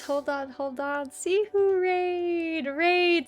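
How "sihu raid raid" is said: high, drawn-out happy cheer; excited raid shout. Real, not acted. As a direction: greet the raid with glee.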